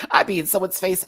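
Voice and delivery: normal talking voice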